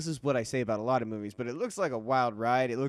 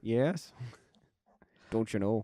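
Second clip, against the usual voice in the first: put-on Minnesota accent; 3 semitones lower, slower than usual. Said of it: "you did a Minnesota accent".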